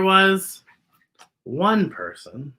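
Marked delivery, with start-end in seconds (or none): none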